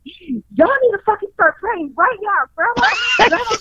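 high-pitched mock-hysterical plea; frantic urging. Mother urging kids to pray for Grandma, acted high and frantic. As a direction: act out a frantic order to the family.

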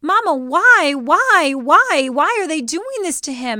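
high, loud mock wailing; hammed-up childhood anguish; distraught child, retold. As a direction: whine each why like a heartbroken kid. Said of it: "I was a mess".

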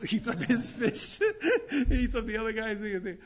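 words broken by laughter; laughing while talking. Real, not acted. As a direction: laugh through the lines. Narrating through laughter.

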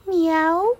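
high little meow; a rising miaow; cat meowing. A narrator's reading. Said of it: "valaki megint azt mondta".

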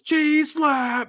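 high goofy cartoon yell; goofy shout. Wrestler naming his cheese slap, high goofy yell. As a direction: announce the move in a silly high voice. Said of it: "turns around and says".

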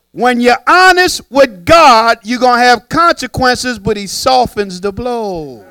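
loud, slow sermon cadence; preaching. Acted. Pastor preaching to the congregation, loud and slow. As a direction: drive the point home from the pulpit.

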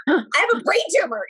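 high-pitched retold panic; incredulous, hammed-up alarm; freaking out. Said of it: "You know, I'm freaking out".

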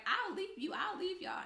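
hammed-up petty ultimatum; mocking friend's threat.

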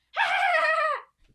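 loud, high, over-the-top cackle; a big theatrical evil laugh; dramatic villain laugh. Acted. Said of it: "You don't have to do that laughing".

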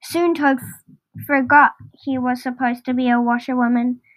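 ordinary delivery, nothing marked

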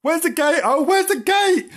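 high, loud kitten panic; panicked panting. A narrator's reading. Kitten fleeing the dog, frantic. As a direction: squeal it frantically. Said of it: "panted Bernard".

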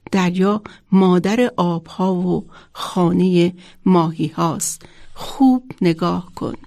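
usual speaking voice

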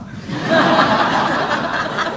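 loud high rising laugh. Real, not acted. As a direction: let out a big high laugh. Laughing at his karma.

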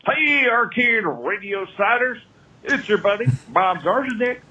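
ordinary talk, nothing marked

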